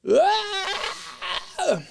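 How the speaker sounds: high, drawn-out mock scream of pain; hammed-up shriek, held high without much volume; Chekhov scream. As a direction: imitate a hurt man's high wail. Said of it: "to get hurt and scream".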